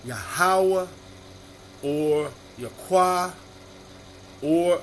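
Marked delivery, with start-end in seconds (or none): 0.0-0.9 Yahweh said as yuh-HOW-uh; alternative name pronunciation. Shown as a demonstration. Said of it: "Another ministry may choose to pronounce". 2.6-3.3 Yahweh said slowly as yuh-KWAH; alternative name pronunciation. Shown as a demonstration. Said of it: "Another ministry may choose to pronounce".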